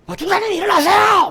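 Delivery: very high, loud yell; shouting wake-up. Retold or imitated. Young soldier yelling at sleeping sergeant to wake up. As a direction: shout him awake.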